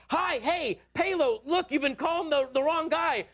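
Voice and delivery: eager. Retold or imitated. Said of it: "I'm like".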